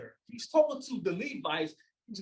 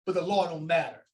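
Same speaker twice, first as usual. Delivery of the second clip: ordinary talk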